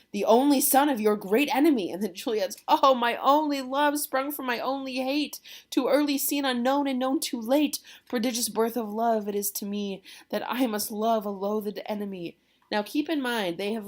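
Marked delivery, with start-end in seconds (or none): 0.1-1.9 loud, higher, emphatic acted announcement; dramatic revelation. Nurse breaking the news to Juliet, loud dramatic revelation. 2.7-12.3 loud, high, hammed-up anguish; melodramatic lament. Juliet learning Romeo is a Montague, melodramatic acted lament.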